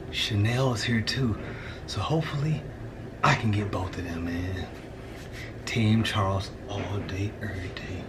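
low, hushed voice so she won't hear; hushed scheming. Real, not acted. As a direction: keep it secret, nearly whispering. Wife home, hiding the scheme.

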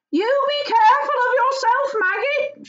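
high, loud wifely call from the doorway; farmer's wife calling out. Acted. Wife calls her husband off at the door, high fond call. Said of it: "she called".

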